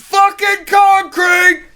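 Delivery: high, slow, angry shout. Real, not acted. Dog owner shouting over friends interrupting, angry. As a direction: yell it out in real frustration. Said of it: "I'm getting angry".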